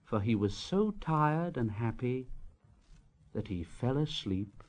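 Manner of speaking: fading sleepy close. A narrator's reading. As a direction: let the voice fade to sleep.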